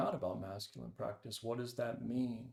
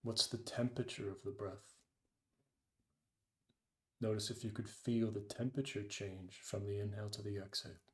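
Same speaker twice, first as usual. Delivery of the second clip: guided meditation. Acted. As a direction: ease them into the breath, unhurried. Leads a meditation.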